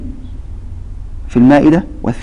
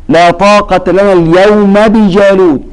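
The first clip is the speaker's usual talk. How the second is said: slow, raised recitation of the verse; slow Quran recitation; 4 semitones higher, slower than usual. Shown as a demonstration. Teacher recites the verse for the student, slowly. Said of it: "أو قوله جل وعلا".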